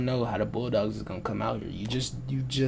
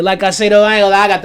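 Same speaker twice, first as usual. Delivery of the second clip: much louder, higher burst; real raised-voice interjection; sudden outburst; 6 semitones higher, 13 dB louder, faster than usual. Real, not acted. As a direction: burst in loudly over your own ramble. Sudden burst mid ramble.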